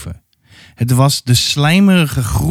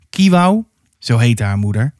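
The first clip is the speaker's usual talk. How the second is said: usual speaking voice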